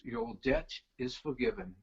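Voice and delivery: put-on low, grave English accent. Bandleader pardoning a lost bet, in a solemn English accent. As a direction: pronounce it with mock English gravitas. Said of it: "gravitas that comes with that English accent".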